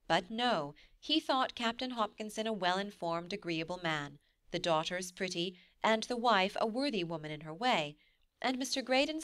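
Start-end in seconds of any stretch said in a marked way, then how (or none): none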